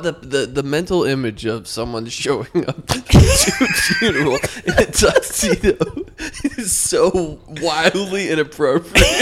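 cracking up mid-sentence; laughing. Real, not acted. Friend laughing at the image of the tuxedo funeral. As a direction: talk through giggles.

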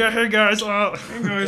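high, far-off Kermit voice; Kermit-like Mahomes impression. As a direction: imitate a squeaky frog-like voice. Imitating Mahomes' voice.

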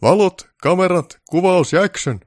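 slow, high, loud set call; grand, drawn-out director's yell; director's shout. Acted. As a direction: shout the cues out one by one across the set. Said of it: "herra Baltasar huusi".

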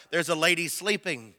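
ordinary talk

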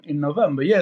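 usual speaking voice

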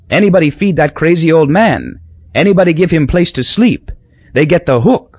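a hint of a grave local cabbie; island driver. Driver's warning to tourists.

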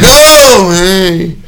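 high, loud oh falling to hey; feigned friendly greeting. Retold or imitated. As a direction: imitate greeting someone you'd rather avoid. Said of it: "someone that doesn't wanna see you".

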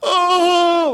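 high, drawn-out moan of release; acted relieved moan. Man reliving the best neck adjustment of his life, high relieved moan. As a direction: re-enact blissful relief with a high "oh".

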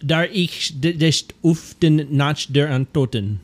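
slow, halting mock German; German accent. Shown as a demonstration. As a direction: sound out German slowly in a put-on accent. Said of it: "Actually, I was speaking German".

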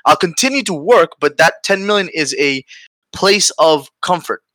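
normal talking voice

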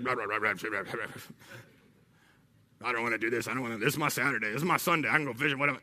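low, soft mock grumbling; grumbling complaint. Reluctant worker asked by God to serve, grumbling. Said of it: "constant grumbling and murmuring, complaining".

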